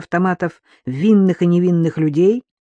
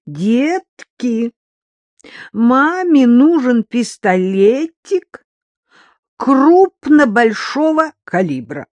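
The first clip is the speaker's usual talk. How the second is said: high, loud, slow singsong; drunken wheedling; 6 semitones higher, 5 dB louder, much slower than usual. Acted. Drunk mother begs children for a pistol, wheedling. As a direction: coax the kids in stretched, drunken tones.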